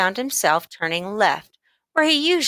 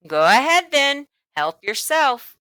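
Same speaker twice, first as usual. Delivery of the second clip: sly storekeeper; 6 semitones higher, 4 dB louder than usual. A narrator's reading. Storekeeper eyeing the coin in John's hand, sly and playful. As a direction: tease the boy knowingly.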